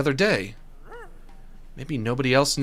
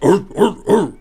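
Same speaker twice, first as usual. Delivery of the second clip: normal bark imitation; 7 semitones higher, 10 dB louder than usual. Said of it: "instead of just".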